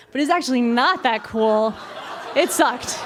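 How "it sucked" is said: plain reading voice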